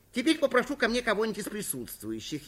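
usual speaking voice

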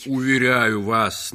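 loud, drawn-out protest; flustered, recovering. Acted. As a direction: protest while struggling to collect yourself. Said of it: "с трудом приходя в себя". (громко, с трудом приходя в себя)

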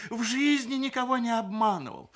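high, loud protest of innocence; panicked babbling. A narrator's reading. Groom defending himself to grandmother, panicked. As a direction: plead your honesty at the top of the voice. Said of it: "залепетал Сергей Николаевич".

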